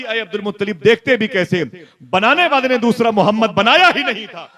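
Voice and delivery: high, loud proclamation; voice from above. Acted. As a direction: proclaim it like a voice from heaven. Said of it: "आवाज आई". (आसमानी आवाज़ की तरह ऊँचा, बुलंद ऐलान)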